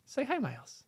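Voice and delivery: high soft coo at a pet. Real, not acted. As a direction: coax the cat sweetly. Cooing at cat.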